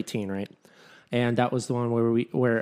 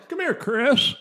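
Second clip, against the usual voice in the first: higher, louder creepy call with a laugh; Herbert voice, laughing; 10 semitones higher, 5 dB louder, slower than usual. Pretend. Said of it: "There you go".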